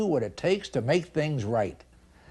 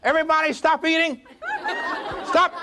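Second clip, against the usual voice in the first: raised voice halting everyone; commanding the room; 10 semitones higher than usual. Retold or imitated. Manager tells the diners to stop eating, raised commanding voice. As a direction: stop the whole room cold. Speaker commands whole restaurant.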